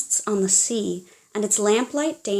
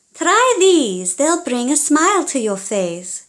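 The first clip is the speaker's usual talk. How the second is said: high, louder sing-song offer; bright hammed-up mask maker; 6 semitones higher, 5 dB louder than usual. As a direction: coax the gloomy girl cheerfully. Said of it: "said Donna Violetta Rufina Zangara".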